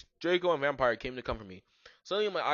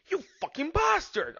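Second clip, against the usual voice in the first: high-pitched outburst; angry insult; 4 dB louder than usual. A narrator's reading. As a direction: fling the insult in a squeal. Acted quarrel between rivals.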